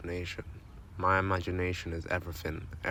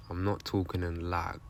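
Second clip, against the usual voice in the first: ordinary talk, nothing marked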